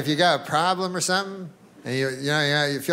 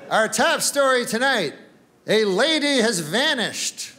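raised anchorman voice, slow and grave; newsman; 5 semitones higher, slower than usual. Retold or imitated. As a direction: announce the headline like a TV anchor. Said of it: "And he goes".

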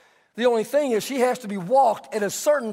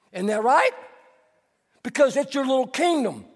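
ordinary talk

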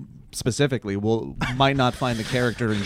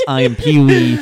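Australian Pee-wee; 9 dB louder, slower than usual. Retold or imitated. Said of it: "with an Australian accent".

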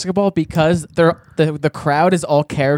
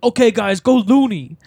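mock coach pep call, higher; coach command; 5 semitones higher than usual. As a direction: give the team the go signal. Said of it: "he's like".

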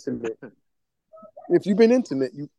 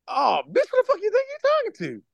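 mock affront; 14 semitones higher than usual. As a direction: pitch leaping up into a squeal. Acts out joking insults to brothers.

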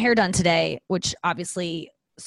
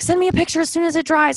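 mock eager hairdresser; 10 semitones higher, 5 dB louder than usual. Quoting the hairdresser.

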